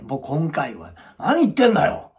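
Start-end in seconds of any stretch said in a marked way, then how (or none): 1.2-2.1 higher, louder mock rant; imitating an angry man. Husband scolding his wife at the clinic, mock angry. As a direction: speed up into a pushy rant.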